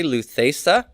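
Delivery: slow, deliberate chanting of the paradigm; teacher chanting endings for students to repeat; drill recitation. Shown as a demonstration. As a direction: lead the class through the forms aloud. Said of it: "Back to the feminine form, everybody".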